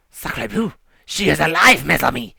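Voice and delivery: exaggerated French accent, loud and excited; a hammy French accent, thrilled; Francois French accent. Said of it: "speaking in a very exaggerated French accent".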